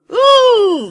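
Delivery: very high, loud shocked shriek; shrieking ooh. A narrator's reading. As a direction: squeal in outrage. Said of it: "screamed Agnes".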